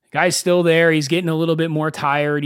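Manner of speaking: ordinary talk, nothing marked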